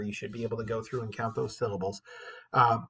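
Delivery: ordinary talk, nothing marked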